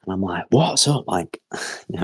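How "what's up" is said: mock shout, pitched high; half-hearted holler at raised pitch; retold shout. Shouting back to mum from the bathroom, mock shout. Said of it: "I'm shouting".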